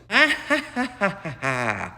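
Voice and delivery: high, distant villainous laugh. Acted. Villain gloating over the captured world, laughing. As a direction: cackle with glee at the trap.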